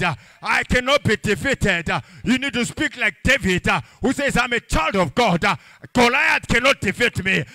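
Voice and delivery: loud, rising sermon cadence over the music; preaching at full force. Acted. Pastor rallying the congregation, loud preaching. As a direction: preach it to the whole church.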